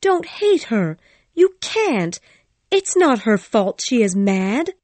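high, urgent plea; crying out. A narrator's reading. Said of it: "I cried".

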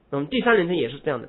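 ordinary talk, nothing marked

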